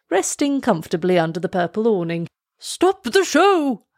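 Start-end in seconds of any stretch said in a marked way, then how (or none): 2.6-3.9 high, slow royal command; a king barking out an order. Acted. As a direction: halt everything with a king's order. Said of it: "commanded the king".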